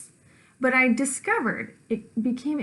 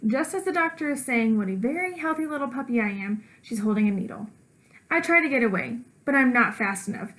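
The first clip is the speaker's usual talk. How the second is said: usual speaking voice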